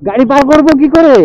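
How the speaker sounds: উঁচু গলায় চেঁচিয়ে জিজ্ঞেস; দূরে শোনানোর মতো হাঁক; জোরে প্রশ্ন. Real, not acted. Shouting question across the water. (গাড়ি পারের কথা, জোরে চেঁচিয়ে)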